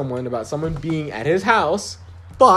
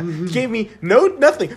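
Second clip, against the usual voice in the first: usual speaking voice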